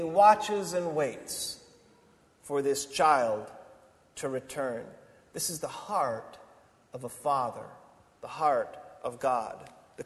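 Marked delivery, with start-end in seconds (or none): none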